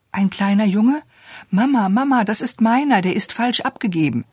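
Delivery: put-on high, breathless-with-excitement child's protest; excited outcry. A narrator's reading. Little girl claiming the baby before her mother, all excitement. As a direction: cry out in high-pitched agitation. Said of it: "stieß einen Schrei aus".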